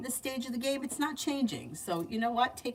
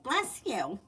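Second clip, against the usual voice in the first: mock-sweet, lightly raised pitch; overly nice coworker; 3 semitones higher than usual. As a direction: imitate an annoyingly nice colleague. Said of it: "she was always very nice".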